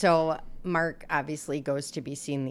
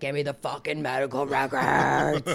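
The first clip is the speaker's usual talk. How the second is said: hint of a devil snarl, growled; 3 semitones lower than usual. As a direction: lower snarling demon growl. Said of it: "that was my devil voice".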